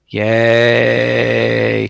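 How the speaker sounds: long, drawn-out mock "yay"; small crowd's cheer. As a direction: imitate a tiny rally crowd, holding one level note. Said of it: "they're all like".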